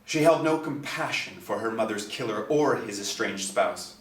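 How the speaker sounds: plain reading voice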